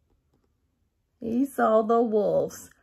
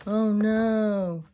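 plain reading voice

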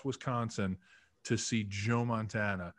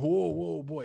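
lightly imitated North Carolina drawl, raised in pitch; hint of a Southern coach calling a halt; Alex Gibbs's Southern accent; 5 semitones higher, slower than usual. Line coach stops his players at the turn, light Southern accent. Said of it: "Southern North Carolina accent".

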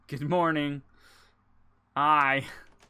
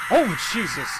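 panicking gamer; 4 semitones higher, 8 dB louder than usual. Real, not acted. Startled during horror game.

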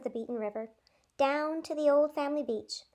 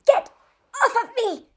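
wheezing; 11 semitones higher, 9 dB louder than usual. A narrator's reading. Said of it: "wheezed her mom".

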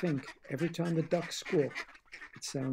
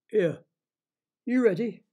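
loud, higher cheeky frog call; 7 semitones higher, 9 dB louder than usual. Acted. Frog calling out to the children, loud and high. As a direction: hail the kids like a bossy little frog.

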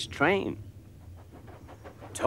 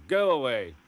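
gruff diesel voice. Acted. Diesel dismisses Bill.

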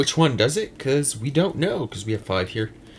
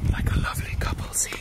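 whisper; 5 dB quieter, faster than usual. Real, not acted. Whispered aside on walk.

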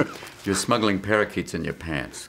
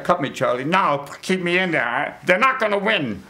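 higher-pitched mock boxer refusing defeat; battered boxer; 8 semitones higher than usual. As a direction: plead with the cornerman like a hurt fighter. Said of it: "It's like".

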